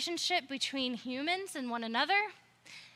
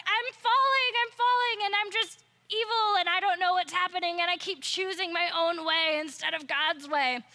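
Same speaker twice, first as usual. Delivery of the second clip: much higher, louder, a hammed-up cry that settles back down; falling man panic; 4 semitones higher, 4 dB louder than usual. Preacher voicing a falling flannel figure for the kids, mock panic. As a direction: squeal like a cartoon figure tumbling, then calm down. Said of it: "He's like".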